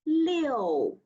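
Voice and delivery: "liu." falling sharply from high, fourth tone. Shown as a demonstration. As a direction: drop "liu." hard. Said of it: "Line number fourteen".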